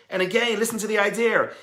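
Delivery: ordinary delivery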